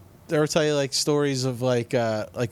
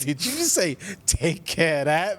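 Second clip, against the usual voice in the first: incredulous question; 6 semitones higher than usual. Retold or imitated. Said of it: "He's like".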